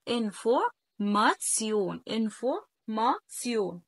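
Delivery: انفورماتيون broken into slow syllables, ending "tsion"; German pronunciation. Shown as a demonstration. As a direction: model انفورماتيون slowly for learners, ts not sh. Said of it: "بنقول انفورماتيون". (نطق انفورماتيون مقطع مقطع، بتسيون)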